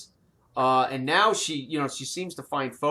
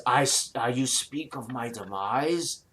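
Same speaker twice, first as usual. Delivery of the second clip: put-on old dragon murmuring. GM voices dragon.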